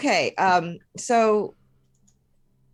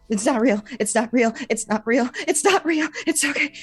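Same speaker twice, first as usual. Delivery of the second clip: panicked self-soothing; 8 semitones higher than usual. Acted. Character panicking after shattering.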